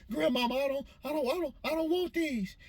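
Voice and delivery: put-on high childlike whine; little boy begging his grandmother not to eat the peas; whiny little boy. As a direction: plead with grandma like a small kid. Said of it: "I was like".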